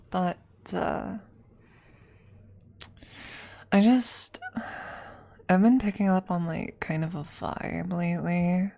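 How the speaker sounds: hesitant crush, lightly voiced; shy awkward classmate; nervous classmate roleplay. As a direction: fumble through inviting a classmate over. Roleplay classmate invite monologue.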